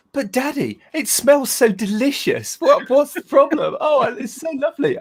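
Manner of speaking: high, pleading puppy voice, with a laugh; dog's innocent voice. Pretend. Owner voices his dog pleading with Dad innocently. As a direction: play the dog begging Daddy, all innocence.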